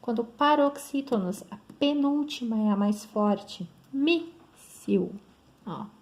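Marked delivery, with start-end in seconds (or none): 3.9-5.1 míssil split as MÍS, pause, sil; stress on penultimate syllable. Shown as a demonstration. Teacher showing students a paroxytone, míssil stressed on MÍS. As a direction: hit MÍS in míssil and set the ending apart. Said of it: "penúltima sílaba é a mais forte".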